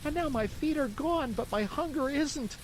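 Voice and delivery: put-on high puppet boy voice, pitched well up; a hungry wooden boy telling his woes; high, childlike marionette recounting his night; Pinocchio's voice. Pinocchio tells his story.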